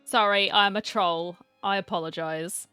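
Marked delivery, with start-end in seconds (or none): none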